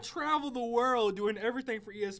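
hammed-up orator leaning hard on each phrase; Stephen A. Smith. Pundit ranting about the turkey that came to see him, loud mock indignation. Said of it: "what Stephen A quote would be your reaction".

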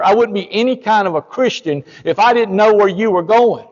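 high, loud, pressing sermon voice; urgent, loud warning of hell; fiery warning. Retold or imitated. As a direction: lean on him hard, preaching hellfire. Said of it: "I just scared a man".

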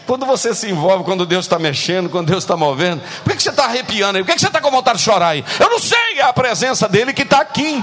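loud, high, fast preaching; impassioned preacher climax; fiery preaching. Acted. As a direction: drive it home, rising to a shout. Preaching at full force.

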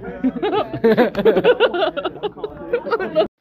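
breaking into high giggles; high burst of laughter. Real, not acted. Laughing at his own fake guest's answer, high giggling. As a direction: crack up at your own bit.

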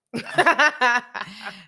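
big high laugh out loud; real loud laugh; loud burst of laughter. Real, not acted. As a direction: crack up at your own joke. Reader laughs at own joke.

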